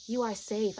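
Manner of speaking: slow, tender reassurance; speaking love. Retold or imitated. Guide telling a frightened friend she's safe, slow loving tone. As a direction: soothe them slowly, phrase by phrase. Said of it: "I'm speaking in this tone".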